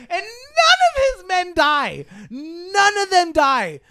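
high, slow wailing complaint; mock crying. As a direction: wail it in mock despair. Mock outrage.

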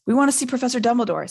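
nervy but determined; rather brave. A narrator's reading. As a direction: speak up bravely to a stern teacher. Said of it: "said Hermione rather bravely".

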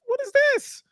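high-pitched, incredulous squeal of a question; mock shock. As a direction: imitate a man startled by what he sees. Retold shocked reaction to the turkey.

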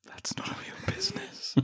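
hushed, whispered, very upset; overdone solemn whisper; mock solemn. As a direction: whisper it as if deeply wounded. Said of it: "she'd say it solemnly".

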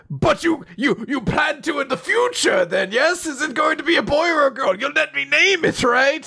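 high, excited pushy father; eager dad. Retold or imitated. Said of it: "the dad hears this and immediately".

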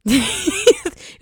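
a sudden high burst of laughter; loud laugh. Real, not acted. Friend laughing at her own confession, loud burst of laughter. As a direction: laugh out loud, caught off guard.

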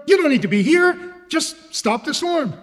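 acted impatient bargaining; insistent request. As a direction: wave off the company, demand the fix. Acted bargaining demand to God.

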